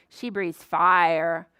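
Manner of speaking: normal talking voice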